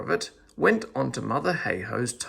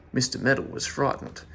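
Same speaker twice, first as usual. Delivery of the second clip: ordinary delivery, nothing marked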